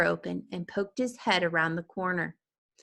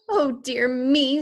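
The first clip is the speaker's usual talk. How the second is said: slow, high dismayed oh dear; a mother's horrified little prayer; mild fright; shocked gasp; 6 semitones higher, 6 dB louder, slower than usual. A narrator's reading.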